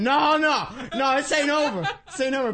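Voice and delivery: loud, high, furious refusal; hammed-up angry caller. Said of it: "He said".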